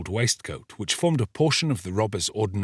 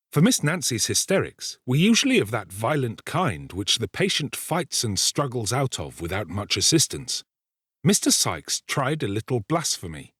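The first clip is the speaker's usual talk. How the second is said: ordinary delivery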